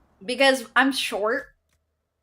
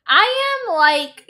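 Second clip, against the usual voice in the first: loud; 9 semitones higher, 6 dB louder, much slower than usual. Real, not acted. Outburst about the family.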